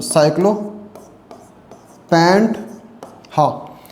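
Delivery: ordinary talk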